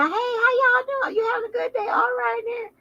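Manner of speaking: very high sugary polite greeting; fake friendly. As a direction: sing it out sweet and fake.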